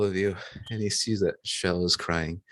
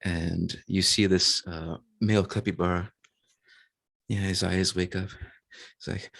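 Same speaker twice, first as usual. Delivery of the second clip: ordinary delivery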